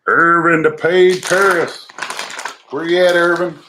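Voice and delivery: high, loud call out to a viewer, slow at first. Real, not acted. Greeting viewer Irvin in the chat, loud high call. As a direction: sing out his name across the room.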